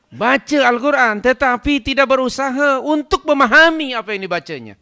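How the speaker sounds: high, loud declamation; full-force preaching. Real, not acted. Preacher listing who abandons the Quran, full-force preaching. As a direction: pitch it up and proclaim every word.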